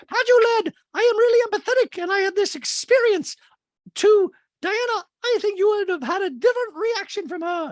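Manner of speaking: very high, loud, emphatic caricature; mocking quote. Host quotes housewife explaining her empathy, high loud mock voice. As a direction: lecture her in a piercing mock voice. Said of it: "Reed's like".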